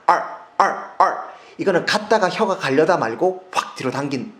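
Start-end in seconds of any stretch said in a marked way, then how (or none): 0.1-1.2 long curled American R; curled R demo. Shown as a demonstration. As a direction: roll the R like rolling gimbap. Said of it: "R 이렇게 됩니다".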